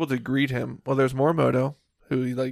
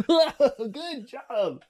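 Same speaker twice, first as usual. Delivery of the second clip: laughing; 5 semitones higher, much slower than usual. Pretend. Plays along with mock praise.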